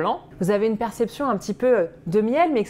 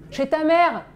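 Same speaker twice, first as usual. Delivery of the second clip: cheeky shout; 6 semitones higher, 4 dB louder, slower than usual. Acted. Cheeky retort to own question.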